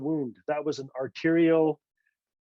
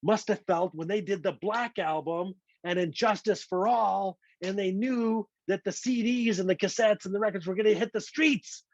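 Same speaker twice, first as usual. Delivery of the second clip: pitched-up excitement climbing to the last words; elated exclamation; 5 semitones higher than usual. Retold or imitated. Writer recalls being lucky to get this lullaby, excited outburst. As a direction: gush with awe, rising higher as it builds. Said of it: "I was like".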